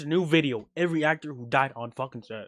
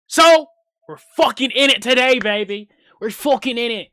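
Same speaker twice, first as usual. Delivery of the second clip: hyped shout; 6 semitones higher, 10 dB louder than usual. Real, not acted. Hyped-up vlog intro.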